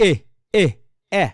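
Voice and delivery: এ cut short as a quick "eh"; a short, single "e" for each এ, no glide; short vowel demo. Shown as a demonstration. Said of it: "একটা short হয়". (এ ছোট স্বরে, না টেনে)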